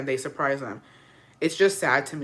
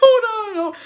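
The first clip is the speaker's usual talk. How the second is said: high, loud, laughter-strained; fighting off laughter; 7 semitones higher, 8 dB louder than usual. Real, not acted. Host trying to explain to listeners, about to crack up. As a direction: keep stalling as the laugh wells up. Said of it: "the reason I'm laughing".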